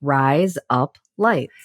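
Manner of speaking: very slow, each word apart; slow repetition. Shown as a demonstration. Co-host repeats after the host, slow and separated. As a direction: repeat it word by word, falling at the end. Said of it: "say it a little faster now".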